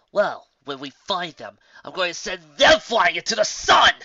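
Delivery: building to a furious loud shout; crude threat. Acted. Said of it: "Rainbow crudely said".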